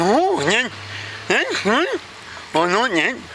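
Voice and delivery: high, garbled character voice; joke character Vicky. Acted. Vicky answers the boss's job offer, high garbled voice. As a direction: mangle the words in a high put-on voice.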